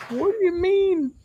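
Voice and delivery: very high, drawn-out mock bafflement; baffled disbelief. Retelling his reply to a shady seller, high baffled disbelief. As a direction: squeak it out slowly, as if totally lost.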